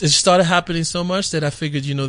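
usual speaking voice

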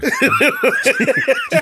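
long, loud, high-pitched laugh; loud high laughter. Real, not acted. Laughing hard at the accent joke, loud high laugh.

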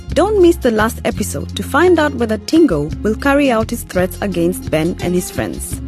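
usual speaking voice

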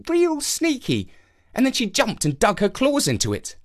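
put-on high young boy voice; excited little boy storytelling. Jake tells a story.

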